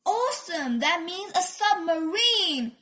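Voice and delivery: high, thrilled exclamation; excited cry. A narrator's reading. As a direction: draw it out with delight. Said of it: "Minnie cried".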